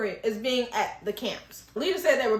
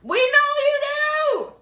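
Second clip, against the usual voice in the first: very high, loud, drawn-out singsong; hammed-up "gotcha" squeal, slowly stretched; mocking taunt; 13 semitones higher, 6 dB louder, much slower than usual. Taunting a character for wanting control.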